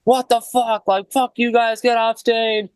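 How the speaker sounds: high, mock screeching abuse; imitating a furious drunk woman yelling at the band; angry homeless lady. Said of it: "was just like".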